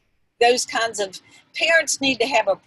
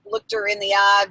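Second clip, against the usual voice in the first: ordinary talk, nothing marked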